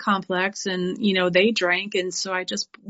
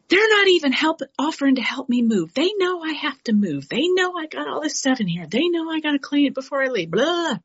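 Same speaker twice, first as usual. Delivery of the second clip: high, heated rant, much higher; retold angry rant; 7 semitones higher than usual. Ranting to a sobriety sister about moving help. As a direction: vent the grievance fast and hot. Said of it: "coming in hot, angry, cussing".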